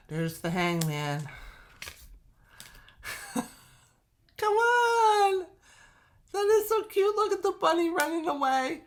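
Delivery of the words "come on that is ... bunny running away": high, loud gushing over cuteness; very high, excited cooing; delighted squeal. Real, not acted. Gushing over cute cards.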